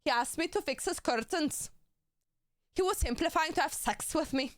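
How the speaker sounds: hammed-up Scottish, tickled by it; mock Scottish accent. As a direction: imitate a Scottish character from a show. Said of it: "it was Scottish".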